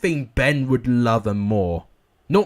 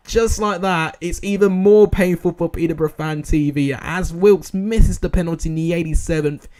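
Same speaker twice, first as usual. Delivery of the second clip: loud, emphatic play-by-play; stunned live commentary; 4 semitones higher, 8 dB louder than usual. Real, not acted. Commentator's curse strikes Peterborough fan, emphatic live commentary. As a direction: call the late winner in disbelief.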